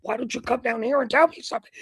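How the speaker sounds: fast, high-pitched mock challenge. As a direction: act out a worked-up call to come down. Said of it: "I'm getting more animated".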